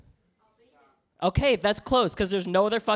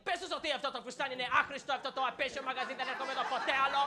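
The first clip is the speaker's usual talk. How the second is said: soft, rapid, higher-pitched muttering imitation; grumbling Greek mom; 4 semitones higher, 10 dB quieter, much faster than usual. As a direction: mutter complaints fast under your breath, as mom. Mom grumbling complaints for child to translate.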